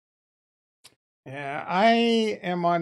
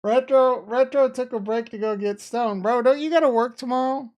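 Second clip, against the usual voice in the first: much higher, loud teasing call-out; 7 semitones higher, 9 dB louder than usual. Real, not acted. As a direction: tease a viewer loudly. Calling out to chat.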